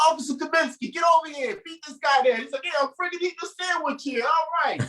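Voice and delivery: put-on high-pitched old-time New York Irish cop accent; Irish New Yorker accent. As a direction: play a sixties police officer in a hammed-up Irish New Yorker accent. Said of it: "That's my Irish New Yorker accent".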